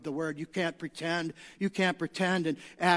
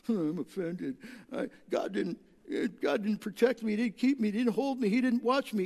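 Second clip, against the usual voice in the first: mock offended whiner; 3 semitones higher than usual. Mimicking an offended believer.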